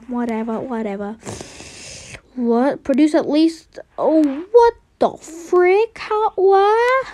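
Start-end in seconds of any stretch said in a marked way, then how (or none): 4.0-7.1 climbing higher with each question; baffled disbelief. Real, not acted. Player baffled by the game, pitch climbing in disbelief. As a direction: let the voice rise in bewilderment. Baffled by what the plant produces.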